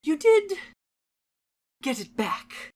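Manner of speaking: eerie cheerful salesman voice; sweet and unsettling, then snapping; creepy polite merchant; Happy Mask Salesman. Acted. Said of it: "The Happy Mask Salesman".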